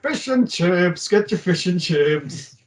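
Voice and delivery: slow, drawn-out hawker's call; mock fish-and-chip seller's holler; street vendor's cry. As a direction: sing out your wares like a street seller. Voicing a street fish-and-chips seller.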